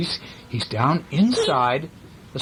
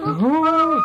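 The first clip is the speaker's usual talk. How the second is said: very high, loud mock train hoot; train whistle woo; 5 dB louder than usual. Shown as a demonstration. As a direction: hoot like a toy train for the kids. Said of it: "you're both right".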